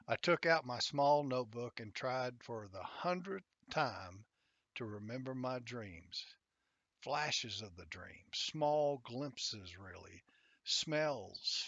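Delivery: usual speaking voice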